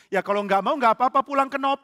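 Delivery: loud, high, impatient retort; exasperated. Acted. Bandit telling hesitant priest to go home, exasperated. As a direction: throw it back at him, voice rising.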